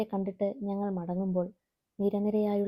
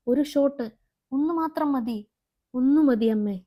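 1 desperate begging; 4 semitones higher, 6 dB louder than usual. Acted. Addict begging for a shot.

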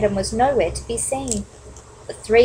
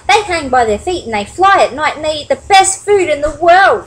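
higher, louder storybook bat voice; animated young character, pitched up; explaining eagerly; 6 semitones higher, 6 dB louder than usual. A narrator's reading. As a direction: explain it keenly to your bird friends. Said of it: "Stellaluna explained to the birds".

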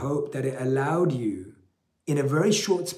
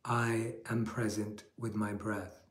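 guided meditation; 2 semitones lower, 6 dB quieter than usual. Acted. Leads a guided breathing meditation.

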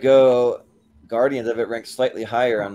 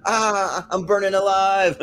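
high mock scream of burning; hammed-up cry of burning alive; 8 semitones higher, slower than usual. Voicing a guy in a burning car.

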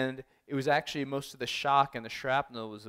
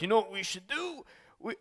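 put-on Brooklyn accent, pitched up; higher-pitched mock Brooklyn voice; 8 semitones higher than usual. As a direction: imitate a crusty cameraman in a Brooklyn accent. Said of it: "I don't know why I give him a Brit-, a Brooklyn accent".

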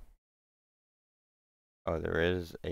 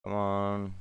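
pursed lips. Real, not acted. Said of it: "My lips are pursed".

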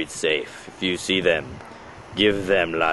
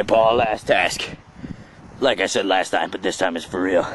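normal talking voice

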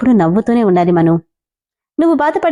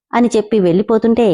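plain reading voice

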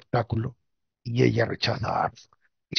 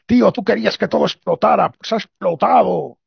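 mock defiant retort; 7 semitones higher, 6 dB louder than usual. Acted retort about the explosion.

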